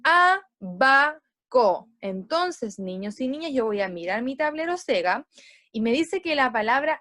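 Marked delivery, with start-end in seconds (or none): 0.0-1.2 "Abaco" slow and loud, split A... ba... co; stressed syllable demo. Shown as a demonstration. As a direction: lean on the A of "Abaco", syllables apart. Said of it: "la vocal A".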